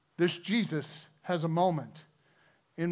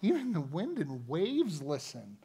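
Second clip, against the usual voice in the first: softer, awestruck murmur; awed hush; 6 dB quieter, slower than usual. Retold or imitated. Disciples after the storm stops, a hushed awed murmur. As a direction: breathe it out in disbelief. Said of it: "they went".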